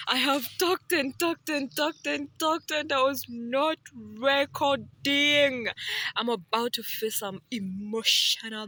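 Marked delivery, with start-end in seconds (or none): 0.1-5.7 louder, higher, slowing on "not recording"; frustrated higher, louder complaint; exasperated outburst. Real, not acted. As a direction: vent the frustration of lost footage. Realizes recording failed, exasperated.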